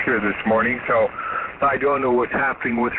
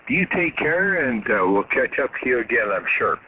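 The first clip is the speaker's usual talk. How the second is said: ordinary talk, nothing marked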